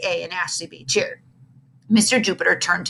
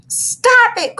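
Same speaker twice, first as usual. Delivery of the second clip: high, loud protest; upset kid shouting stop; crying out; 5 dB louder than usual. A narrator's reading. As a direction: cry out for them to stop. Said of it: "cried Ashley".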